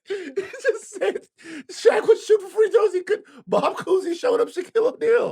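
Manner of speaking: very high, squealed through laughter; laughing disbelief. Real, not acted. Co-host recapping the absurd claim, helpless laughing disbelief. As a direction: barely get it out, cracking up.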